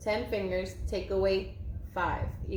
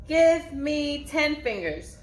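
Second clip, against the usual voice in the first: slow teacher call; 6 semitones higher, 4 dB louder, slower than usual. Shown as a demonstration. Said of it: "teacher drawing out a command".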